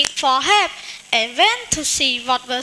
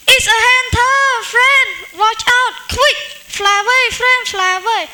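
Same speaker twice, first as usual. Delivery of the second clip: urgent warning shout; 7 semitones higher, faster than usual. Acted. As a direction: yell to save your friend. Ant warns bird of hunter.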